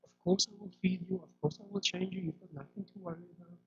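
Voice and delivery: quiet soothing murmur to a fussing infant; soothing mother. Retold or imitated. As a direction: hush the baby with gentle reassurance. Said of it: "they would say something along the lines of".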